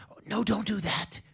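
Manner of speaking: a retold eager pitch, lightly acted; mildly excited foreign diplomat; excitedly. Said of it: "And very excitedly".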